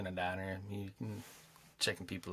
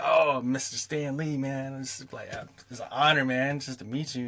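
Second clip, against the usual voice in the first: louder and higher, gushing at a hero; fanboy babbling with excitement; starstruck fan; 4 semitones higher, 6 dB louder than usual. Retold or imitated. Fan meeting Stan Lee.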